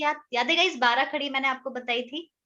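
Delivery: ordinary talk, nothing marked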